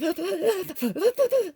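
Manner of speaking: high, loud mock wailing; imitated pain crying. Showing viewers how much pain she was in, imitated crying. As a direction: act out a burst of pained wailing. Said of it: "I was in so much pain".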